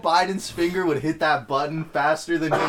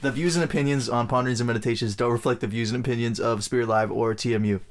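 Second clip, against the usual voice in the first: fast legal disclaimer voice; disclaimer read; 4 semitones lower than usual. Acted. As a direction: rattle it off like small print. Reads a disclaimer.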